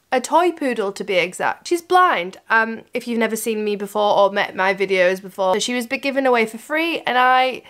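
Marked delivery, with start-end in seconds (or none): none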